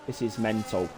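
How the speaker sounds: normal talking voice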